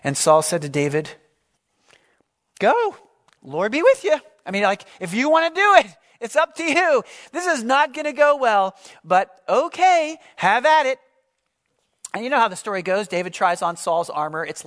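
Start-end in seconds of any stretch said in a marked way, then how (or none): 2.6-11.0 much higher, louder skeptical shrug of a voice; reluctant doubtful king. Acted. Saul doubtfully allowing David to fight, high skeptical voice. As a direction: give permission you don't believe in.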